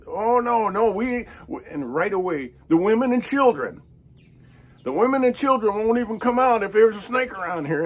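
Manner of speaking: high, loud, frantic protest; panicky man. Retold or imitated. Snake-fearing man insists on protecting women and children, frantic. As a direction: squeal it like the snake is a catastrophe.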